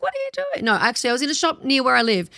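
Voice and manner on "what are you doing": high squeal of a surprised fan. Retold or imitated. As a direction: squeak it out like a startled stranger. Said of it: "she was like".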